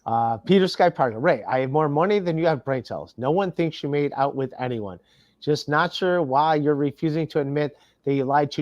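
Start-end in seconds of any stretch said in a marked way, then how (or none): none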